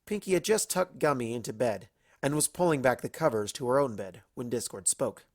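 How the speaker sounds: ordinary delivery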